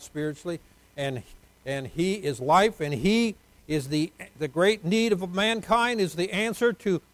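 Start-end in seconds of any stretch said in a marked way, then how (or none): 1.6-7.0 loud, slow, drawn-out proclamation; forceful preaching. Real, not acted. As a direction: hammer each phrase slowly from the pulpit.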